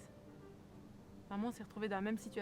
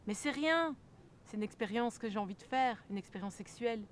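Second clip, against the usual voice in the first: mocking father's excuse; 3 semitones higher, 6 dB louder than usual. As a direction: throw his excuse back at him, loudly. Acted quote of father's excuse.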